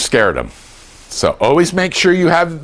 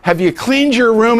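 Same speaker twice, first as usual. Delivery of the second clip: nagging parent; 9 semitones higher than usual. Retold or imitated. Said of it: "looked at our kids and said".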